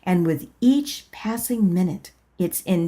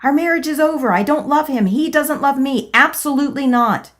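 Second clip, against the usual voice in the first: higher and louder, refusing; loud protest; 7 semitones higher, 6 dB louder, faster than usual. Acted. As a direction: push back hard against the voice. Protesting to inner voice.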